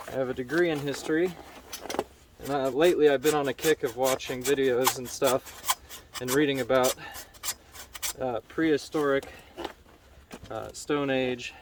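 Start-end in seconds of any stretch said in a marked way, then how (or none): none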